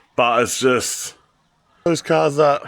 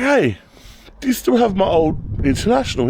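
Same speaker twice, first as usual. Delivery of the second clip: eager greeting; 6 semitones higher than usual. Retold or imitated. Phoning old buyer eagerly.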